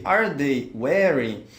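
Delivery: each word clear and unhurried; slow, careful English. Shown as a demonstration. Teacher to students, modelling the question slowly. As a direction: spell out the question slowly for learners. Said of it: "models the question for students".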